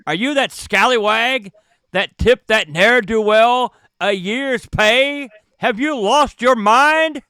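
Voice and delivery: loud, high outraged tirade; a pompous man losing his temper; indignant rant. Acted. GM voices outraged passenger.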